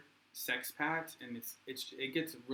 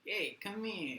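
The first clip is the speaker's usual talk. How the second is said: condescending call; 6 semitones higher, 3 dB quieter, slower than usual. Retold or imitated. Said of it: "and be like".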